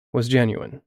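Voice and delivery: usual speaking voice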